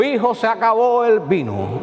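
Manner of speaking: a pompous, solemn announcement to her son; mock solemn. Shown as a demonstration. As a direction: proclaim it like an overly formal reading. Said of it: "¿Ustedes creen que le dijo eso?".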